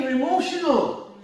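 usual speaking voice

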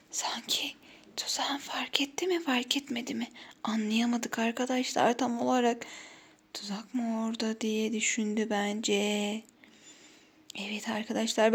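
hushed whisper to the viewers. Real, not acted. Secret commentary to viewers, unvoiced.

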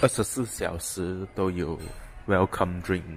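usual speaking voice

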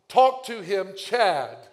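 high, loud banner proclamation; mock announcement. Pastor imagining God's neon banner, hammed-up loud proclamation. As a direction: boom it like a flashing neon sign.

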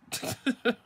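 short high chuckle; laugh. Real, not acted. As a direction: chuckle at your own bad luck. Laughs after mock yay at cold.

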